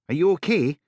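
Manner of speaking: high, loud anxious check; worried. Acted. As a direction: make sure she's hurt. Duncan checking child.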